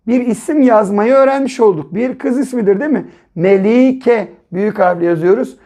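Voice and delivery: ordinary talk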